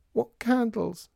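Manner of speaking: very high, small puzzled voice; plaintive child. A narrator's reading. Child in storeroom asking father, plaintive. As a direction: pipe up helplessly from the back.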